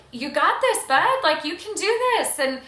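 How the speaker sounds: put-on high, loud cheering on a nervous child on a bike; encouraging a kid. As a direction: pump the kid up, then ease off. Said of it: "we're telling this kid".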